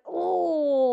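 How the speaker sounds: high, drawn-out mock "oh"; stretched high comic moan of realisation; imitated long oh. Host imitating the woman facing toilet water, mock drawn-out oh.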